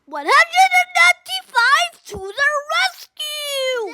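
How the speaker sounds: high rallying cry; heroic call. Acted. Rescuers calling out as they come, heroic. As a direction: announce the rescue boldly.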